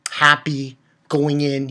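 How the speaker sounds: ordinary talk, nothing marked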